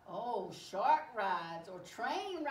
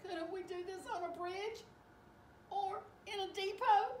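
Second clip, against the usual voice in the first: high-pitched train character voice; worried little train, voice raised high; pleading train; 11 semitones higher than usual. Acted. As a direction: ask for a safer place, pitched high. Says the train.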